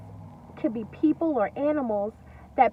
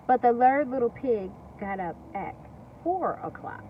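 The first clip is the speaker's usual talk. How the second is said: plain reading voice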